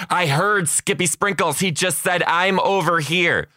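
a hint of a convinced child; imitated kid.

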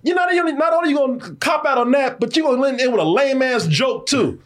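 high, shouting rant at the writers; indignant rant. Real, not acted. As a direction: yell your complaint high and outraged, half laughing. Ranting at the show's ending.

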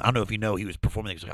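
usual speaking voice

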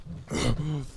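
a soft gasp, holding in a laugh; quiet sharp intake of breath; stifled gasp. Retold or imitated. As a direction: choke back the laughter with a gasp. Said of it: "so I went".

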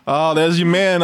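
ordinary delivery

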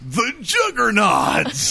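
high, slow, drawn-out shout; team battle cry. Retold or imitated. Players at the net chanting at rivals, drawn-out high shout. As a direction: bellow the team name like a war cry. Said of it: "and then we'd go".

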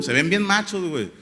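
normal talking voice

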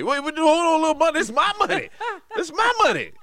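high, loud indignant protest; outraged retort. Retold or imitated. Father to son over the lost money, high loud outrage. Dad outraged at son's excuse.

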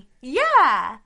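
loud mock-energetic greeting; perky female caller. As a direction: imitate an over-bubbly girl. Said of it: "How would that sound from a female".

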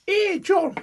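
high, far Essex accent, mocking; imitating Sarah's Essex voice. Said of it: "she talks like this".

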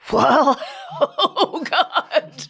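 laughing through the words, high and slow; a pained laugh, then a whispered question; helpless laugh. Retold or imitated. Retold helpless reaction, laughing.

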